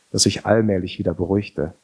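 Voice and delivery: ordinary delivery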